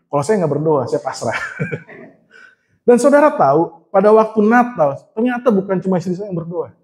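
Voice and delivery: ordinary talk, nothing marked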